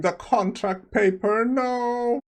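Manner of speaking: hammed-up cry over the torn contract; retold panicked no, rising high; mock scream of dismay. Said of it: "everyone starts screaming".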